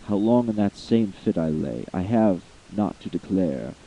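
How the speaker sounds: usual speaking voice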